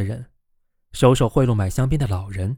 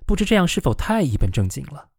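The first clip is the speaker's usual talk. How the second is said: plain reading voice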